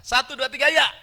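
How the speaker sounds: high, shouted cue to read together; rallying count, punched high and loud. Real, not acted. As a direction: call the count out to a whole congregation. Cues congregation to read together.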